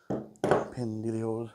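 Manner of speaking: usual speaking voice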